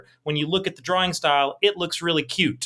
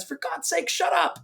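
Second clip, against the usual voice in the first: high-pitched, held-back yell; exasperated mock scream; 13 semitones higher than usual. Husband silencing his wife mid-attack, exasperated mock scream. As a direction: imitate a husband at the end of his patience, high voice. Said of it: "the guy screams at her".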